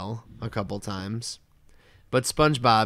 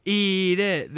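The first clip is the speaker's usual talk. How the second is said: high, drawn-out goading chant; high-pitched imitation of a jeering crowd; crowd egging on; 9 semitones higher than usual. Crowd goading Neptune to eat the burger, egging on. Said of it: "then the crowd is like".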